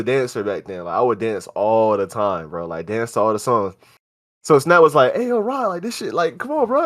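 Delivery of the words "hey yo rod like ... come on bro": high-pitched imitation of an eager friend; excited high-voiced pleading to join in; friend coaxing. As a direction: jump up high, egging him on. Friend coaxing narrator to dance.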